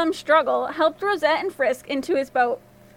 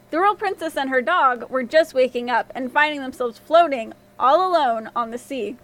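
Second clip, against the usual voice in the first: plain reading voice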